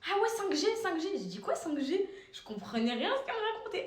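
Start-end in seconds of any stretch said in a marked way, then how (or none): 0.1-1.1 much higher and louder caricature of the aggressor; aggressive woman's voice. Retold or imitated. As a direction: screech it like the furious stranger. (voix de l'agresseuse, très aiguë et forte)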